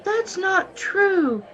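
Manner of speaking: high, slow, anguished protest; pleading denial. Acted. Accused girl protesting to the marshal, high slow anguished denial. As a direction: cry out the denial in disbelief.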